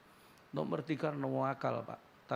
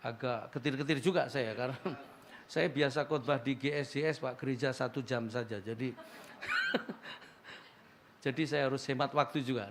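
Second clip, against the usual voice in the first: ordinary talk, nothing marked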